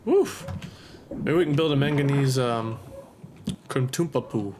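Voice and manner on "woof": high woof. Real, not acted. Relief after success.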